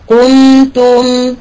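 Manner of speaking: قمتم drawn out slowly; careful model of قمتم; slow demo word. Shown as a demonstration. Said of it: "هنا القاف جاء بين".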